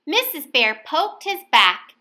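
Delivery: usual speaking voice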